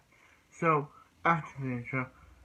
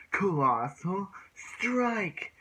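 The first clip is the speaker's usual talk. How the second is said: drawn-out, high anime attack call, very slow; hammed-up attack announcement; special move shout; 7 semitones higher, much slower than usual. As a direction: stretch the move name out like a cartoon hero.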